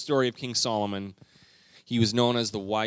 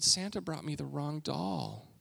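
put-on teary child; 5 semitones higher, 6 dB quieter than usual. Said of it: "she got teary".

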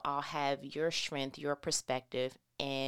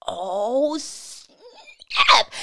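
excited; 8 semitones higher, 12 dB louder than usual. Real, not acted. Excited exclamation.

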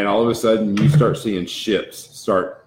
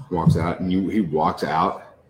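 normal talking voice

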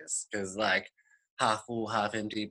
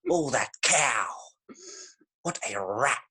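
indignant nan. Retold or imitated. Nan scolding the Desperate Housewives, mock indignant. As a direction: mimic a nan cursing TV characters. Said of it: "after you hear your nan say a few animal names".

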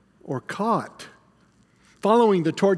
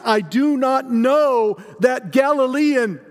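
slow, raised, higher disavowal; emphatic denial; 5 semitones higher than usual. Acted. Peter disowns Jesus before accusers, emphatic denial. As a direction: deny it forcefully, drawing out each word. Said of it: "denying her accusation".

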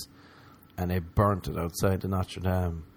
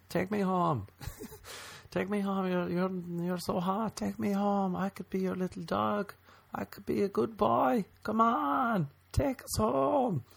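high, softer pleading puppy whine; begging puppy voice; 11 semitones higher, 5 dB quieter than usual. Retold or imitated. Himself begging the woman to take him home, high soft whine. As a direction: beg her like a little dog.

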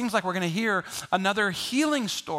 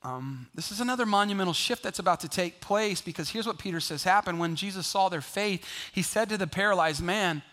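ordinary delivery

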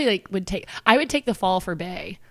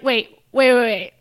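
loud, higher mock-drunk urging; imitating a tipsy mom; 4 semitones higher, 8 dB louder than usual. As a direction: play a wine-drunk schemer egging them on. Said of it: "a little bit wine drunk".

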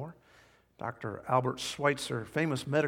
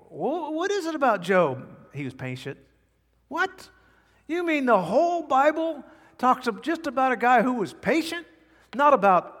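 much higher, louder, incredulous voice of a doubter; mock disbelief; 10 semitones higher, 5 dB louder than usual. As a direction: play the scoffer who can't believe it.

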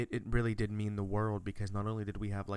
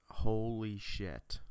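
slow, drawn-out "Holy shit"; imitated awed reaction; much slower than usual. Brother stunned by the game's graphics, imitated awe. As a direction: stretch both words in retold amazement.

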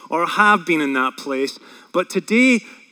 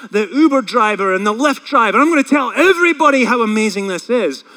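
eager, rising and louder; excited new believer; 3 semitones higher, 5 dB louder than usual. Pretend. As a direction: gush like a brand-new convert. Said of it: "You're like".

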